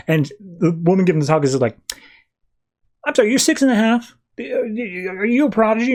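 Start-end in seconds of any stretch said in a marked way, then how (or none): 3.1-5.9 imitated high-pitched disbelief; incredulous question. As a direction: question it in mock astonishment, pitched well up. Woman amazed at child's age.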